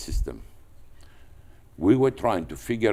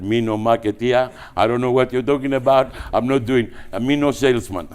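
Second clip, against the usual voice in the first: mock broken English; 5 semitones higher, 7 dB louder than usual. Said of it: "I fainted".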